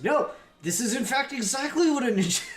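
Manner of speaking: loud, high-pitched insistence breaking into laughter; hammed-up outburst, cracking up at the end; mock-indignant correction. Podcaster rebutting imagined moviegoers about ninjas, loud mock indignation.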